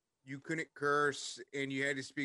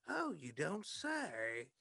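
slow, drawn-out mock-Liverpool reply; goofy Liverpool accent; 2 semitones higher, 4 dB quieter, much slower than usual. Impersonator answering a husband in line, goofy Liverpool accent. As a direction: stretch it out in a put-on Liverpool accent. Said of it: "the accent I did the entire time".

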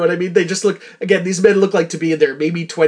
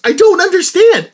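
incredulous; 11 semitones higher, 6 dB louder than usual. Real, not acted.

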